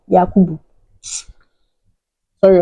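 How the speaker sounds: ordinary talk, nothing marked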